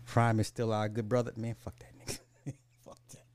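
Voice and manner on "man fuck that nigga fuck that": whispered aside, half laughing; muttered aside. Real, not acted. Muttered aside about Prime.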